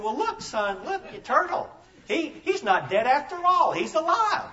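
high, eager discovery; excited dad. Acted. Dad telling his son the turtle lives, high and thrilled. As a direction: point out the live turtle with glee. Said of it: "The dad said".

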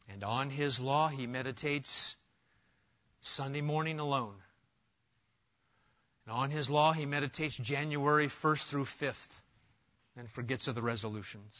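low, soft deadpan misquote; mock misreading. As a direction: slip the false verse in quietly, tongue in cheek.

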